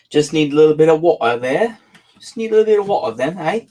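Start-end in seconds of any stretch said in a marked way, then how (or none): 2.2-3.6 playful Cockney, quick and a bit higher; a joking Cockney narrator, quick; Cockney accent. Shown as a demonstration. Reader tries a Cockney accent between chapters. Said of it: "I'm working on my Cockney accent then".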